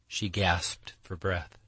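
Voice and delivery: plain reading voice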